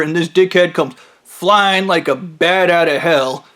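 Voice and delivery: ordinary talk, nothing marked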